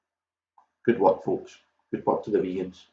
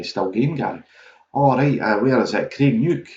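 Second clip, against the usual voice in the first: usual speaking voice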